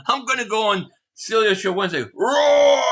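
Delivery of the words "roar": loud, high lion roar. Pretend. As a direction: belt out a big lion roar. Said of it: "add a roar soundbite".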